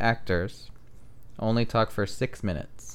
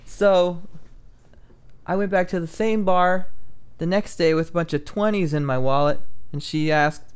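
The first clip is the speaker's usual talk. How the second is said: high-pitched comic setup with deliberate pauses; stand-up joke delivery; 9 semitones higher than usual. Acted. Comedian telling friends a stolen bar joke, stand-up delivery. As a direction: project it like a comedian on stage, questions pitched up.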